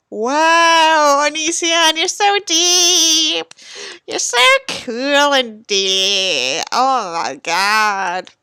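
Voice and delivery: slow mocking drawl; mock him with fake awe; slowly sneering at the author; sarcastic. Real, not acted. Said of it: "sarcastic remark on the text".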